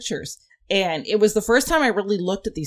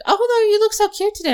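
high fast gushing compliment; gushing coworker; 13 semitones higher, 3 dB louder, faster than usual. Retold or imitated. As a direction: gush like an excited coworker.

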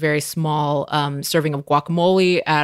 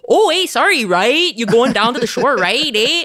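high, loud mock Canadian accent; Canadian accent imitation; 5 semitones higher, 4 dB louder than usual. Host imitating locals in DC with a Canadian accent. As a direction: play up a cheery Canadian accent. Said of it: "everyone I talk to is like".

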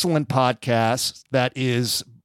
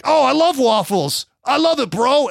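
much higher, louder, gushing bro; [name] impression; 10 semitones higher, 4 dB louder than usual. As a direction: squeal it like an excited brother. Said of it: "[name], will always be like".

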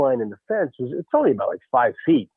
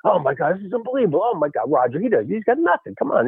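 high-pitched excited chatter; mildly hammed-up playoff excitement; retold energized teammate; 7 semitones higher than usual. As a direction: pump up the dugout, imitating a hyped player. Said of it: "energized because of the playoffs".

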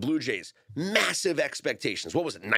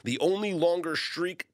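usual speaking voice

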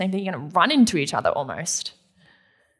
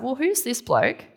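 grumpy servants; 3 semitones higher, 4 dB louder than usual. Retold or imitated. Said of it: "How grumpy would you be".

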